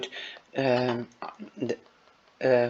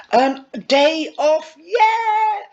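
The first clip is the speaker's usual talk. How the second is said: squealed much higher, the "yeah" way up; excited cheer; 6 dB louder, slower than usual. Acted. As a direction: cheer like a schoolgirl let off school. Fiona cheering the day off.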